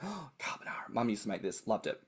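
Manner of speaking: hushed, fond recollection; fond reminiscence. Retold or imitated. Fond memory of mum's cooking.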